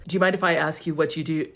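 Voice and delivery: rattled off very fast like a street interviewer; imitating fast talker. Instagram interviewer quizzing a stranger, imitated very fast. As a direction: mimic the guy who talks so fast. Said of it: "And he talks so fast".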